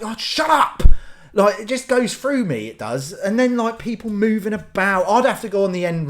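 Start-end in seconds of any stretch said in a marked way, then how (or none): 0.0-0.8 high-pitched irritated outburst; exasperated snap. Acted. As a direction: act fed up with noisy cinema-goers. Annoyed at noisy people in cinema.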